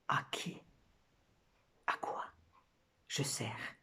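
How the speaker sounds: murmure presque sans voix; chuchotement. Acted. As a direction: chuchoter lentement, entre de longs silences. Femme du poème, doute intime. (chuchoté, comme pour soi)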